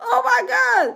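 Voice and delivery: real high-pitched laughing disbelief; weak with laughter. Real, not acted. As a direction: crack up, helpless and high. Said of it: "I am weak".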